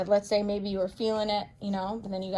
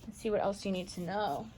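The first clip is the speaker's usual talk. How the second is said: ordinary delivery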